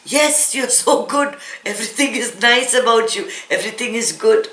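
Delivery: pat them on the head, dripping with irony; mock reassurance. Teacher mocking the self-pitying listener, sarcastic praise.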